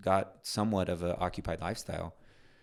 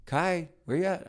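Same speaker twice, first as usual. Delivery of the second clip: calling out; 11 semitones higher, 4 dB louder than usual. Retold or imitated. Calling out through house.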